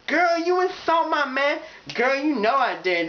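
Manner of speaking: put-on high, smug sassy girl. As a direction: throw it in her face. Catfight girls trading sass.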